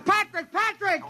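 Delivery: high, urgent calling of a name. Acted. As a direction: call him over the noise. Calling over a bad phone line.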